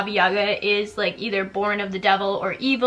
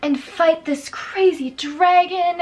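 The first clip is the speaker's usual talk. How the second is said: mock heroic tale; 5 semitones higher than usual. Mock heroic boy story quote.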